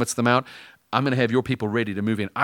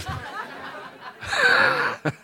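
silent laugh. Real, not acted. Laughing at own story.

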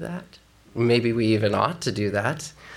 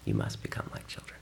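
much lower, whispered; 10 dB quieter than usual. Retold or imitated. Pastor quoting Jesus, low whisper.

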